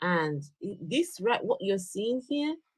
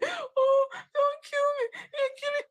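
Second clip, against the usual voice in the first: mock sobbing in a very high falsetto; hammed-up high, babyish whimper; crying like a baby. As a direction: imitate a grown man bawling like a baby. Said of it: "crying like a baby".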